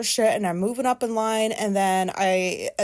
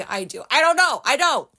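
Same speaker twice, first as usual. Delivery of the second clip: normal talking voice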